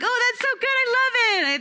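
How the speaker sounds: put-on over-the-top delight at a kid's rhyme; high-pitched mock gushing; gushing praise. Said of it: "I'm like".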